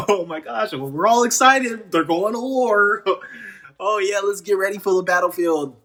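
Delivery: laughing mock enthusiasm; sarcastic excitement. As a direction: pretend to be pumped. Sarcastic excitement about War Games.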